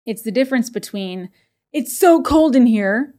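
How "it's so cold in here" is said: higher, louder, slow accusing whine; accusatorial complaint. Shown as a demonstration. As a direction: complain as if blaming someone. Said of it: "almost an accusatorial tone".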